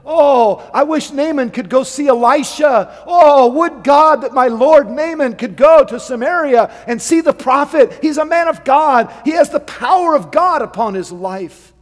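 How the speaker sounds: high, loud, pleading maid; longing servant girl. Retold or imitated. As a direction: cry out her wish for her master. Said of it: "she had a burden".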